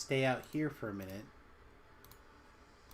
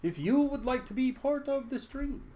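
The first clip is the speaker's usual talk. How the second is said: much higher Australian-ish host voice, slightly off; attempted Australian accent; 12 semitones higher than usual. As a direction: invite viewers in a faltering Australian accent. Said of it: "I wanna be Australian".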